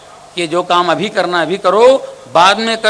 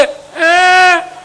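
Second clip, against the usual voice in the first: put-on old man's cry; 12 semitones higher, 4 dB louder than usual. Old man calls God.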